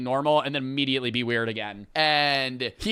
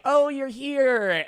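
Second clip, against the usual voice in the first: eerie sweet greeting; 10 semitones higher, slower than usual. Retold or imitated. Possessed girlfriend greets boyfriend calmly.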